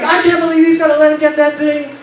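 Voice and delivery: high-pitched mock outrage; imitated outraged woman. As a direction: mimic her indignant complaint, pitch way up. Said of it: "And the woman's like".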